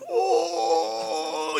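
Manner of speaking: high drawn-out pained moan.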